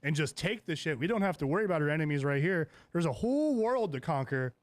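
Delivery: loud, insistent urging; rousing pitch. Retold or imitated. Daemon urging them to leave and conquer, rousing. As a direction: push the grand plan hard, voice lifting. Retold rallying speech of Daemon.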